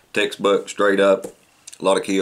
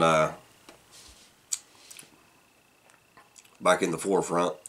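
speaking with pipe in mouth; talking around pipe stem. Real, not acted. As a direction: pipe clenched in teeth. Seen 2: Pipe stem clenched in teeth throughout; reference frames show none.

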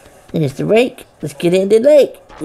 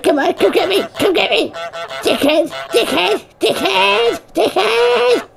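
high jeering taunt building to a shout; 8 semitones higher than usual. Acted. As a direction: goad him, climbing higher each time until you yell. Goose taunting a gardener.

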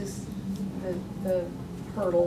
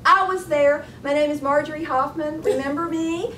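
higher and louder, calling from the back; woman calling out; 7 semitones higher, 5 dB louder than usual. Retold or imitated. Woman at the back of the library calling out to the author, higher and louder. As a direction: call out to the author across the room. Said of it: "she says".

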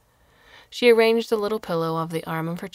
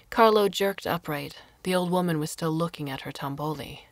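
usual speaking voice

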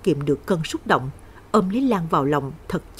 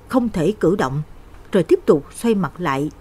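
usual speaking voice

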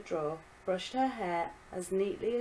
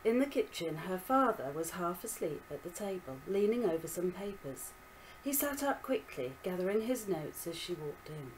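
plain reading voice

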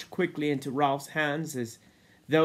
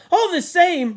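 high, forceful shout; 12 dB louder than usual. A narrator's reading. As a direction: shout it to overrule them. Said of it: "Ralph shouted".

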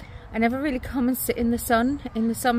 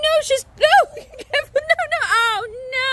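high, laughing shrieks of dismay; laughing in shock; 4 dB louder than usual. Real, not acted. Child falls in mud, mother reacts.

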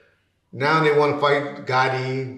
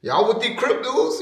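much higher, startled mock shout; retold alarmed shout; 11 semitones higher than usual. Suge seeing rival gang members at the gate, startled shout. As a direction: blurt it out alarmed. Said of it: "And he's like".

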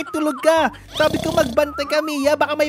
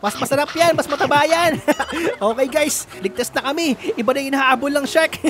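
ordinary talk, nothing marked

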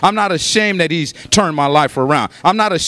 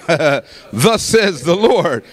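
very slow, drawn-out pronouncement; real prophetic declaration; 3 semitones lower, much slower than usual. Shown as a demonstration. Preacher presents the genuine word of God, slow declaration. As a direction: stretch each word out with weight. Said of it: "this is a, a real".

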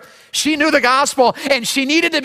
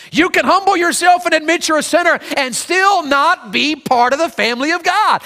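normal talking voice

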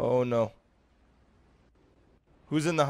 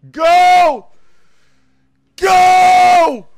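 high, very loud command; urgent yell; 7 semitones higher, 10 dB louder than usual. Real, not acted. Player urging team to reach the delivery, urgent yell. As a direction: bellow it to spur them on.

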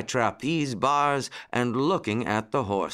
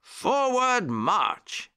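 high, long-drawn marching order; pitched-up, slow parade command; cried command; 11 semitones higher, much slower than usual. A narrator's reading. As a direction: call the troop to march, stretched out. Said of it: "cried Uncle Wiggily".

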